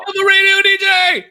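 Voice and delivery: very high, loud re-enacted cheer; over-the-top triumphant shout; ecstatic yell. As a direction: scream it like a dream came true. Re-enacts joy at becoming DJ.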